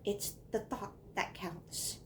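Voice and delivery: slow, whispered punchline; hushed whisper. Acted. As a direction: whisper it slowly, like a precious moral. Hushed moral closing the poem.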